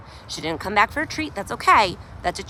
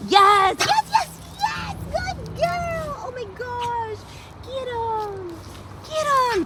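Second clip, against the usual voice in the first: excited squeals; much slower than usual. Real, not acted. Trainer praising her dog with treats, very high excited gushing. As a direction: gush over her, sky-high.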